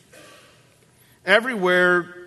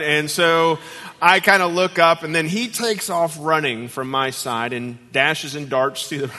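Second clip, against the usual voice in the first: ordinary delivery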